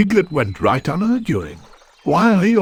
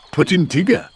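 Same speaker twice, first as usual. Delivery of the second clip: ordinary delivery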